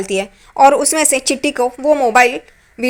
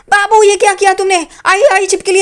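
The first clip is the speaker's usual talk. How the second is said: higher, louder retold panicked cry; mock panic; 6 semitones higher, 4 dB louder than usual. Lizard on his nose, husband cries to wife in mock panic. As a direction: play the husband's comic fright, yelping.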